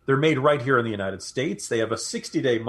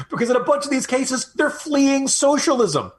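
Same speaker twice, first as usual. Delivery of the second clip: gleeful; 11 semitones higher, 3 dB louder than usual. Real, not acted. Gleeful jab at Democrats' hopes.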